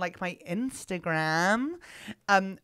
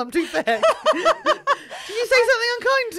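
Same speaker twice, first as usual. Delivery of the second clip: high, loud mock hurt through laughter; mock offended; 14 semitones higher, 7 dB louder than usual. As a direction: play wounded, squeaking and laughing.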